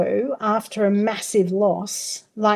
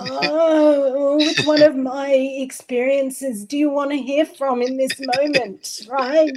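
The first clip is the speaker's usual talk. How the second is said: exasperated; 4 semitones higher than usual. Pretend. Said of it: "how the fuck do you answer that".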